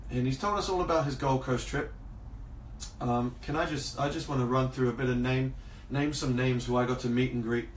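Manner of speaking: ordinary talk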